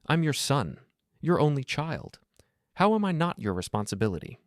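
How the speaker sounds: anger burning through shock and grief; rising anger. A narrator's reading. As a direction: plead the point with growing indignation. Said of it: "anger starting to burn through the shock and grief".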